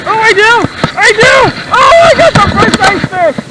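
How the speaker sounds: high, loud burst of triumph; thrilled. Real, not acted. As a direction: cry out in real delight at a first catch. Lands first ice fish.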